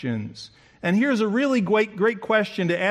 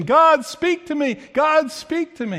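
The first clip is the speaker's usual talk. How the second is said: pleading cry; 9 semitones higher, 4 dB louder than usual. Retold or imitated. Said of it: "many people are saying".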